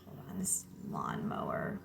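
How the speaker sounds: whispered, breaking off to notice the noise; real whisper aside. Real, not acted. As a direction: drop to a whisper for a quick aside. Aside about noisy lawnmower outside.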